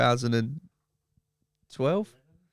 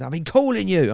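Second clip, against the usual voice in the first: higher, exasperated mock call; retold exasperated call; 6 semitones higher than usual. Partner upstairs to husband, retold exasperated call. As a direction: quote the annoyed partner in raised pitch.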